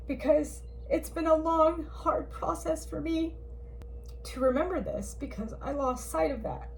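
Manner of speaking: getting emotional. Real, not acted. To her viewers, recalling her hard process, close to tears. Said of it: "I know I'm getting emotional now".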